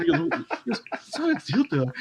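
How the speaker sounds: helpless giggling retelling; cracking up. Real, not acted. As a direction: barely get the retort out laughing. Cracking up over the retort.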